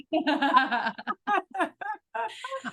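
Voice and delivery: a long, high laugh; high burst of laughter. Real, not acted. Reacting to the Virgo quip with a high, real laugh.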